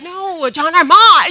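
correcting his friend at the coffee shop, thrilled and laughing; emphatic correction. Retold or imitated. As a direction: insist on "John R. Mott", bursting with excitement. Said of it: "And I'm like".

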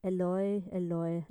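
Eloi called out loud; loud cry. A narrator's reading. Jesus crying out from the cross, loud. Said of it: "Jesus cried with a loud voice".

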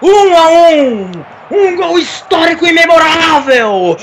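slow, shouted goal celebration; explosive goal commentary. Acted. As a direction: savor every word of the goal. (narração de golaço)

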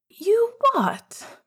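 high shocked yelp. A narrator's reading. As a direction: blurt it out in disbelief. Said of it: "spoke in shocked tandem".

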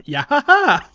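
high, loud squeaky cheer "Yeah, ha, ha!"; a gleeful little creature's victory cry; Korok cheer. Retold or imitated. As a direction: chirp it like a tiny forest sprite. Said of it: "I was half expecting ... like, a".

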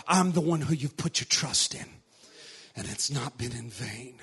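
barely above a whisper, tender and near; hushed voice of Jesus. Acted. Preacher voicing Jesus to the waiting faithful, hushed. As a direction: speak as Jesus, very soft and low. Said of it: "Jesus says".

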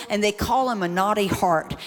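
ordinary talk, nothing marked

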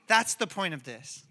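ordinary delivery

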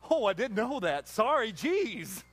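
slow mock apology; sarcastic. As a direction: drip with sarcasm. Mock God feigning ignorance.

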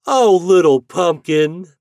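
high, loud, drawn-out call; storybook breezes calling out; merry cry. A narrator's reading. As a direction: cry out slowly to a little friend. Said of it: "they cried".

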